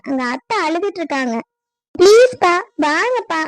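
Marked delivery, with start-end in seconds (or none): 1.9-3.4 loud, high plea to father; urgent pleading. Acted. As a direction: beg him urgently to come. Daughter begs father to stop quarrel.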